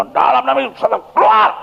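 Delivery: high-pitched shouted exorcism command; teriakan pengusiran setan. Retold or imitated. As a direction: cast the demon out at the top of your range. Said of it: "makin kita teriak, makin setan gemeter". (berteriak mengusir setan, nada tinggi)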